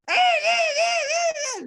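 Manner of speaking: high, slow drawn-out wailing cry; hammed-up crying screech; eagle wailing. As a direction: wail like a frightened bird. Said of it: "empezó a llorar".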